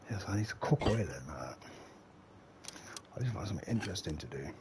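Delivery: whispered aside to himself. Real, not acted. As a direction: say it under your breath, in a whisper. Muttering to himself in game.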